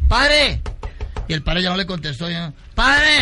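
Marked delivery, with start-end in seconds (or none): 0.1-0.6 high loud call. Acted. Boy calling the vanished priest, loud high call. 2.7-3.2 high loud call. Acted. Boy calling the vanished priest, loud high call.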